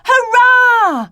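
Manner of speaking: high, loud hurrah; children cheering for A. Acted. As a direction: throw out a joyful cheer. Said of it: "The children cried".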